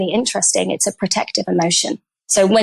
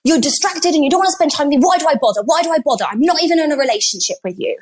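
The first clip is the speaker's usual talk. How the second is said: lightly acted cross complaint, pitched up; cross partner; 6 semitones higher, faster than usual. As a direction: imitate a fed-up partner's higher-voiced rant. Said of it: "and he's really cross".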